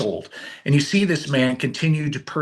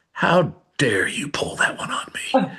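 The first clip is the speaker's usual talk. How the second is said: whispered mock-offended protest; mock indignation. Caught out by dad's question, whispered mock indignation. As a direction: fake outrage in a whisper. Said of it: "And I said".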